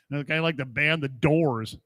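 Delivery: ordinary talk, nothing marked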